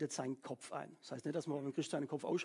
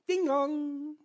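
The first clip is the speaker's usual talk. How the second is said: doorbell ding dong; 10 semitones higher, 7 dB louder than usual. Pretend. Ringing the neighbour's doorbell.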